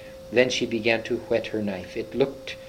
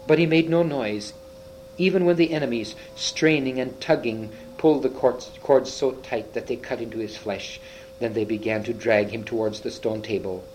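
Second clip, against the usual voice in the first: plain reading voice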